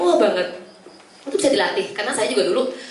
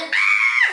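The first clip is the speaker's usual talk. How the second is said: jeritan kaget tinggi menirukan orang tua; orang tua menjerit kaget; 3 dB louder than usual. Retold or imitated. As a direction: tirukan orang tua yang panik melihat anak jatuh. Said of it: "otomatis orang tuanya". (jeritan panik tinggi)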